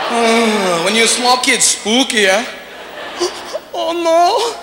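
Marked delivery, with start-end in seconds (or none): 2.8-3.7 sharp breathy gasp of fear; frightened gasp. Retold or imitated. Kid realizing he is lost. 3.7-4.6 put-on much higher, whiny scared kid voice; panicked lost kid.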